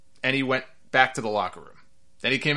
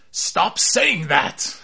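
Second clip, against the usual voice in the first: slow, louder, exasperated plea; six-fingered man; 3 semitones higher, 5 dB louder, much slower than usual. Retold or imitated. Movie villain begging someone to stop repeating a line, slow and exasperated. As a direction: drag it out, fed up with the repetition. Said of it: "the six-fingered man just goes".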